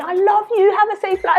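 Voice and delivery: mock mum, high and sing-song; imitating mum. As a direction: coo like a doting mum. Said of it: "And she's still saying".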